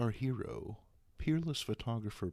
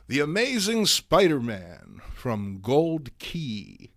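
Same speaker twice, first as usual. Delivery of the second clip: slow, louder title announcement; announcer title; 2 semitones higher, 9 dB louder, slower than usual. Shown as a demonstration. Host presenting the comic book, slow showman announcement. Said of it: "entertain you with".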